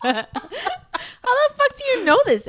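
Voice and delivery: high, loud, slow laughing squeal; laughing disbelief. Real, not acted. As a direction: cackle out the question. Laughing at plot hole.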